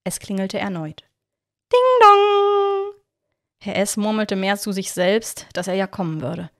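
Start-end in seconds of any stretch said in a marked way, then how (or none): 1.7-2.9 sung high and loud like a bell; doorbell chime. A narrator's reading. Narrator voicing the doorbell that wakes Herr S, high chime. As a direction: ring it out like a doorbell.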